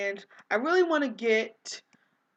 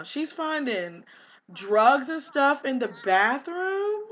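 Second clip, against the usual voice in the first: normal talking voice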